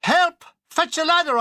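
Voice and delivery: high, loud call for a ladder; shouting at the plane. Acted. Soldier yelling at an American plane overhead. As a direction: yell up at the passing plane. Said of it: "I shouted at it".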